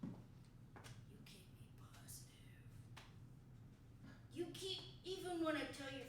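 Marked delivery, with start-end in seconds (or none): 1.3-2.2 breathy whisper. Acted. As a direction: whisper it close. Boss whispers compliment.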